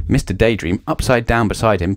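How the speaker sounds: usual speaking voice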